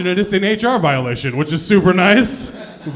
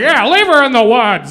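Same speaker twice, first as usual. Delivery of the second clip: higher, louder cheer; crowd cheering; 6 semitones higher, 7 dB louder than usual. Retold or imitated. Audience voice urging him on, louder and higher. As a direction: egg on the killing. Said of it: "It was like".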